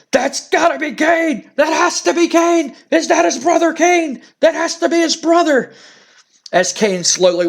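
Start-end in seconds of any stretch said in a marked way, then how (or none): 0.1-5.7 much higher, shouting in disbelief; excited shout. Retold or imitated. Vince McMahon freaking out at Kane's arrival, high excited shouting. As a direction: yell it like you can't believe your eyes. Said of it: "And Vince McMahon goes".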